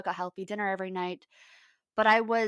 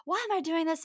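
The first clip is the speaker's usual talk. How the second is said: retold frustrated outburst; 9 semitones higher than usual. Worn-out partner snapping over doing the dishes, frustrated. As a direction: re-enact snapping in exasperation. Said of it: "I kind of snapped".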